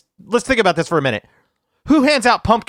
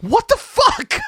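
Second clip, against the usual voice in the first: high, disbelieving what the fuck; shocked high-pitched outburst; incredulous; 13 semitones higher, much slower than usual. Real, not acted. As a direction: squeal it out in disbelief. Incredulous at raw potato.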